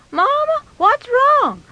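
put-on very high child's voice; worried boy. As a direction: pipe up as a worried little boy. Voices boy asking his mother.

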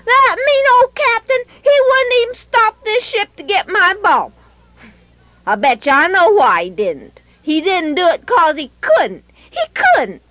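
put-on a boy fuming about the mean captain; furious little boy. A narrator's reading. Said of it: "Benny was furious".

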